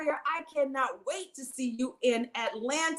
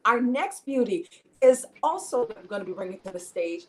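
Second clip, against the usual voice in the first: ordinary delivery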